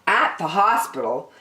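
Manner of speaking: plain reading voice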